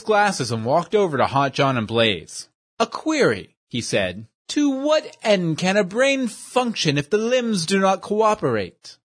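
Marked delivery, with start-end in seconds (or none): 2.8-9.0 higher, fussy, overly formal voice; pompous gentleman. Acted. Portly schemer praises his dim companion, high and prissy. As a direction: play a prissy know-it-all praising his henchman.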